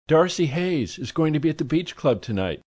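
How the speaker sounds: high, gushy society lady; effusive high society lady; gushing socialite. A narrator's reading. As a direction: swoon over the marvelous man.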